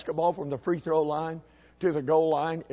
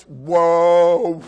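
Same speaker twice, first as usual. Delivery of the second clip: very loud, drawn-out whoa; alarmed halt; 11 dB louder than usual. Retold or imitated. Dad halting his boy's way of walking, loud alarm. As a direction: bellow it to stop him short.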